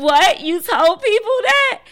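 high, loud incredulous what; shocked disbelief. Retold or imitated. Mom asking her son in disbelief, high and shocked.

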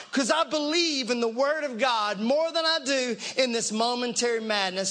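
usual speaking voice

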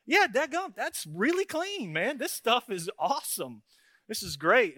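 high, delighted exclamation; amazed. Retold or imitated. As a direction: gush over the clean porch like a sucker sold. Acting amazed at the salesman's demo.

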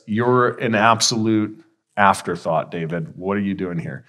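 low, quiet dismissal, trailing down; dismissive brush-off. Acted. Older brother belittling David for showing up, dismissive. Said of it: "minimizing him, rejecting him".

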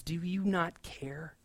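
soft, slow, bitter question. Retold or imitated. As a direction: let the resentment seep through quietly. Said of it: "There's a bitter taste to what Martha is saying".

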